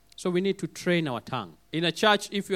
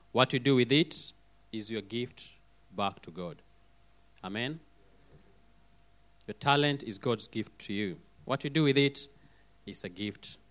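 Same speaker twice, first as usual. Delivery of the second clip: soft closing exhortation; 6 semitones lower, 5 dB quieter than usual. Acted. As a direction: lower your voice and coax the congregation to nurture each other.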